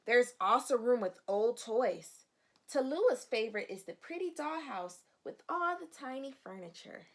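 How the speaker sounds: put-on bright read-aloud voice for children; storybook reading. Teacher reading the storybook to her young students. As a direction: read the page like story time.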